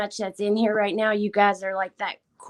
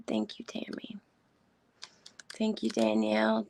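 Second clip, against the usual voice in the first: tearful whisper; 6 dB quieter than usual. Real, not acted. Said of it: "unvoiced whisper".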